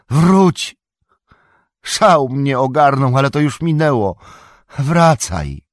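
high, loud pleading calls; wołanie. A narrator's reading. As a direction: shout after a friend to come back. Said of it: "zawołał". (głośne, błagalne wołanie)

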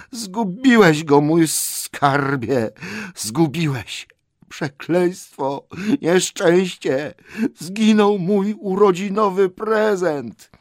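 slow, high-pitched creature wailing; tearful lament. A narrator's reading. Gollum mourns his lost birthday present, wailing. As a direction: lament the loss, drawing out each word. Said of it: "jego płaczliwe słowa".

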